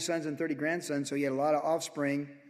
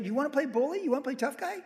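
taunt; 10 semitones higher, faster than usual. Acted. Taunting an enemy challenger.

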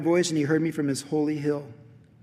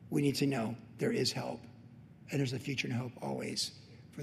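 soft pastoral reassurance; 8 dB quieter than usual. Acted. Pastor promising help to the struggling, soft reassurance. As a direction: let it sink to a quiet assurance.

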